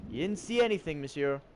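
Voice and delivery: put-on playful French-accented threat; mock French accent. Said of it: "I have to try and sound like a French person".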